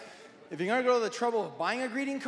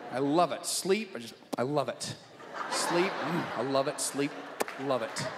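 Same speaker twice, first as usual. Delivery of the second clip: savoring; 8 semitones lower than usual. Acted. Comic savoring his love of sleep.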